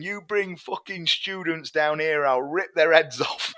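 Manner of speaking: high, loud, slow threat from the back of the hall; angry heckler. Retold or imitated. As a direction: bark it out like a furious local heckler.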